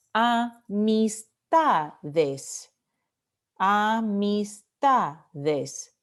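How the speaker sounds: "Amistades" slowly split into a, mis, TA, des; syllable by syllable. Shown as a demonstration. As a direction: sound out "amistades" slowly, stressing TA. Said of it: "penúltima sílaba".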